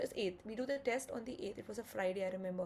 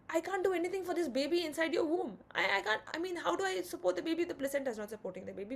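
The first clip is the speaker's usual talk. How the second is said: raised, pleading husband voice; retold pleading; 6 semitones higher, 5 dB louder than usual. Husband begging his wife to accept the C-section, raised and pleading. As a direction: push the words out high and urgent, begging.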